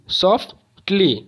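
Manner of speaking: "Softly" slowly in two beats, सॉफ, pause, टली; split into syllables. Shown as a demonstration. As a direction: break "softly" with a pause in the middle. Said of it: "दो में ही ख़त्म हो गया". ("Softly" को दो हिस्सों में रुककर)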